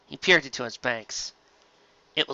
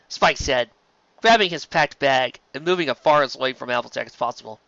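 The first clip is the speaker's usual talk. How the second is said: plain reading voice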